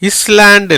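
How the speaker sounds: இஸ்லாண்ட் with short i and sounded S; இஸ்லாண்ட் said "iss-land", the mistake to avoid; wrong pronunciation. Shown as a demonstration. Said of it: "இல்ல".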